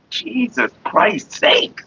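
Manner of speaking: slow, drawn-out, fed up; exasperated. Real, not acted. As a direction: sigh it out in disbelief. Exasperated sigh.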